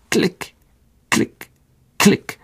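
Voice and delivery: short, separate clicks with pauses; clicking pincers. A narrator's reading. Spider pincers all round the hollow, short isolated clicks. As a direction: snap each click out on its own. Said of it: "machten die Spinnenzangen".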